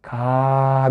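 "Ka" held long, low and soft; sounding out. Shown as a demonstration. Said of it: "Yanına A geldi". ("Ka" hecesini alçak sesle uzatarak okuma)

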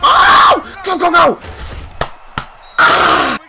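high, loud cheering shout; excited yell. Real, not acted. Gamer screaming at his runner to go. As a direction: urge the runner on at full volume. Big play on screen.

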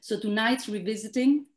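normal talking voice

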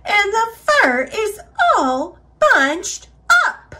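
high, huffy complaint; Mama Bear. A narrator's reading. Mama Bear fussing over bunched fur, huffing. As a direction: stress each word in a high huff. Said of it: "she huffed".

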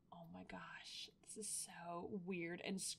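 hushed, breathy whisper of unease; creeped-out whisper. Real, not acted. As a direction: whisper it as if the thing might hear you. Creeped out by possessed sim.

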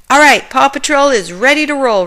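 rally the team to go; excited rallying call. A narrator's reading. Ryder sending the pups out, loud excited call. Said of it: "Ryder exclaimed".

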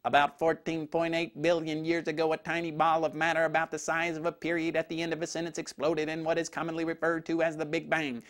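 rapid, low monotone recitation; textbook spiel. Retold or imitated. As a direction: rattle it off like a memorized textbook. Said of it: "Eugene went into the textbook spiel".

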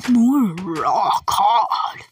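slow, very loud, strange put-on voice; weird drawn-out voice. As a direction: stretch it out in a goofy booming voice. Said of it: "don't know why I said it like that".

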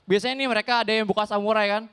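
ordinary delivery

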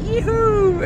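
high, loud cheer of joy; joyful whoop. Real, not acted. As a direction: whoop with delight. Arriving at Istanbul, cheering.

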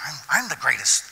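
a light first brag of the disciples; mock boasting disciple. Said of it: "You got the disciples trying to".

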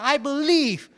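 high exclamation of faith; triumphant cry. Retold or imitated. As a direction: shout your faith as you leap. Jumping up once more.

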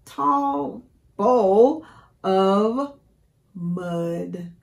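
slow drawn-out emphasis. Shown as a demonstration. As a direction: stretch each word out slowly. Teacher dramatizes the mud.

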